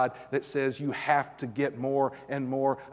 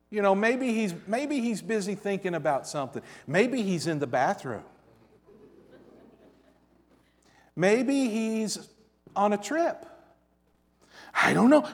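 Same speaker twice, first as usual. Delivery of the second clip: sarcastic mockery; 6 semitones higher than usual. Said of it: "This is tremendous sarcasm".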